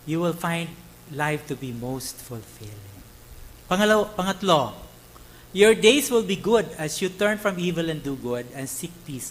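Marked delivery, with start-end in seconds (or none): 0.1-3.1 slowed repeat. Acted. Preacher repeats promise slower.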